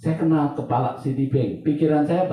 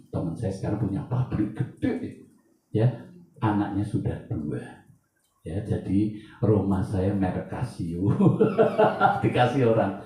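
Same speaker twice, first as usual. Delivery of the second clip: normal talking voice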